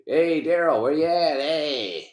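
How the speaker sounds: put-on a light stab at Agent Hanratty's accent, barely off his own voice. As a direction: call out to Daryl in a half-hearted Hanratty accent. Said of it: "I couldn't do that accent".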